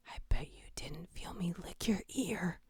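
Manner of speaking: hushed creepy whisper into the mic. Pretend. Streamer reading a creepy chat line, whispered. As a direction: breathe it like a stalker in your ear. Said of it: "whispering something creepy".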